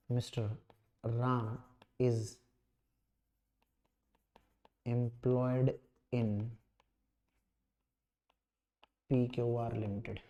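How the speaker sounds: low, measured dictation with long gaps; softly spaced-out classroom dictation; slow dictation. Real, not acted. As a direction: dictate word by word so students can write. Dictates answer for students to write.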